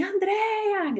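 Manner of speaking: mock call across the terminal; calling out a name. Coworkers calling her name across the airport. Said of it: "Elas, elas gritavam pra mim".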